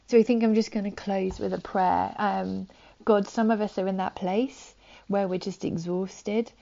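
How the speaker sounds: ordinary talk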